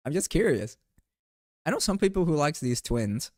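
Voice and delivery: usual speaking voice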